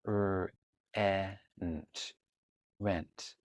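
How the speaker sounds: segmenting "rent" into R, E, nt, then a soft blend; sounding out rent. Shown as a demonstration. Teacher modeling how to sound out "rent" for a child. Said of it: "Last one".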